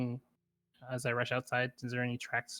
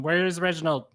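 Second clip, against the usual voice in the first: loud, raised in-character demand; raised-voice yell, higher and louder; yelling at the patron; 4 semitones higher, 7 dB louder than usual. Acted. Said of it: "I yell at the patron".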